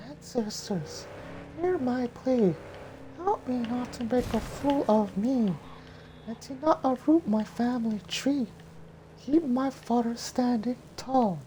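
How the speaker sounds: put-on very high, breathy young heroine; high girl voice. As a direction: lift into a high girlish falsetto. High falsetto Mulan voice.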